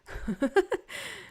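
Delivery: a quick bright laugh after "bored to death"; short laugh. Real, not acted. Laughing at the thought of her old accountancy job, a short high laugh. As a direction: laugh it off lightly, high and amused.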